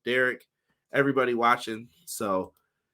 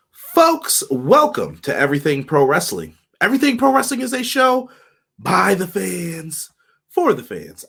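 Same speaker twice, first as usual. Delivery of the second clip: hype announcer; 5 semitones higher, 7 dB louder than usual. Acted. Host's hyped show intro.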